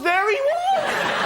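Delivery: very high, drawn-out squeal of wonder; amazed cry. Acted. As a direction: shriek it in slow, overblown amazement. Acted amazed crisp finder.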